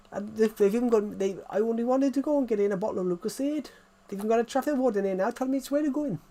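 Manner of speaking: high-pitched, hurried Welsh grumble; Welsh accent. Acted. Imitating the TikTok guy moaning about petrol, Welsh accent. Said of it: "Welsh accent's a bit like this".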